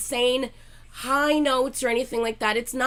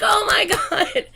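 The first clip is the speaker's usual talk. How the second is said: laughing; 11 semitones higher, 6 dB louder, slower than usual. Retold or imitated. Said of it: "He was like".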